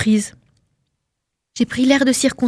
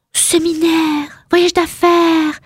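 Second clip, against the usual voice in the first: higher, slow, coy film-star purr; Marilyn style; 8 semitones higher, much slower than usual. Acted. Wife teasing her husband, coy Marilyn voice. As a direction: play it like a sultry Marilyn. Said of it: "j'ai répondu très Marilyn".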